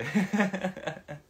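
loud giggling fit; high burst of laughter. Real, not acted. Idol laughing hard at a fan's cheeky comment, loud laugh. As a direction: crack up loudly at the fan's teasing. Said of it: "ちょっとうざい".

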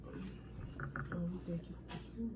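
soft, quick murmured grace; hushed prayer. Real, not acted. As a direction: murmur the blessing under your breath. Saying grace at the table.

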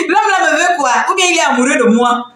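giggling through a teasing question; chuckling tease; laughing. Real, not acted. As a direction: laugh it off, amused. Teasing about the screenshot man.

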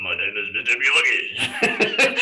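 slow, low put-on Japanese accent with a laugh; Mister Miyagi voice. Teacher imitating Mister Miyagi's Japanese accent for the class. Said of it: "He like".

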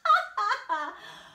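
loud, high horse guffaw; proud mocking laugh. Proud horse mocks the snail, loud haughty laugh. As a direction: laugh haughtily at a tiny rival. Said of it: "laughed the proud horse".

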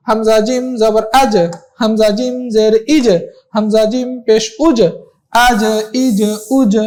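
slow spelling drill, আজ, ইজ, উজ each with a bounced final j; measured drill with a kick after each final j; qalqalah bounce. Shown as a demonstration. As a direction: push the j at the end of আজ, ইজ, উজ into a short echo. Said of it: "একটু ধাক্কা দিয়ে পড়া".

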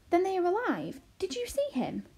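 high, swooping, thrilled question; excited. A narrator's reading. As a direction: burst out with eager hope. Arrietty learns they are alive.

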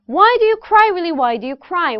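sing-song chant, high opening WHY stepping down to a falling cry; rhythmic tongue twister. Shown as a demonstration. Coach leads students in tongue twister, lively rhythmic chant. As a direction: bounce "Why do you cry" along, starting high and dropping. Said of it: "이 리듬".

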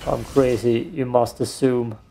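ordinary talk

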